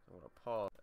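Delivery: usual speaking voice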